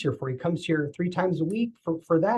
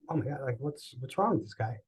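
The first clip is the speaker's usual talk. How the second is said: much softer, shocked aside to himself; hushed inner thought; 2 semitones lower, 9 dB quieter than usual. Retold or imitated. Patient privately alarmed by the stranger's trembling, hushed. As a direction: mutter it under your breath in alarm.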